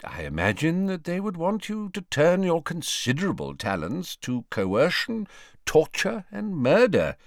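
put-on high, deliberate old wizard; lightly voiced Dumbledore. Headmaster tells old colleague what the enemy wants, deliberate.

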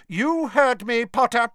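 high, loud and slow, cutting him off; very final. A narrator's reading. Professor ending a student's objections, final. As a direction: close the argument with stern emphasis. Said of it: "in a very final sort of way".

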